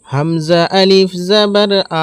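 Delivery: final আ held long and level; one-alif elongation. Shown as a demonstration. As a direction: draw out the closing আ for one alif count. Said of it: "এক আলিফ পরিমাণ টান দিয়ে পড়ে".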